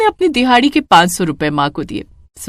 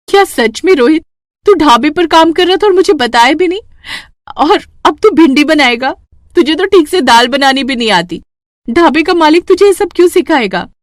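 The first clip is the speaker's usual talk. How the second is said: higher and louder, amazed and doubtful; astonished disbelief; 7 semitones higher, 4 dB louder than usual. A narrator's reading. Mother astonished that her son works at a dhaba. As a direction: fire the questions in happy disbelief. Mother can't believe son's news.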